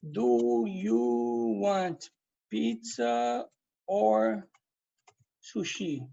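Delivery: very slow, drawn out; slow example question. Shown as a demonstration. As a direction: read the example question slowly. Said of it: "Olha aqui o exemplo". (pergunta de exemplo bem devagar)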